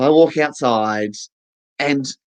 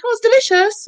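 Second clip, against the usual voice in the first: high delighted mock voice; beautician. Beautician eating the client's cucumber, high mock gush.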